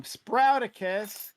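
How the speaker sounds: slow, high, drawn-out name call; drawn-out announcement. Acted. Host announcing guest with flourish.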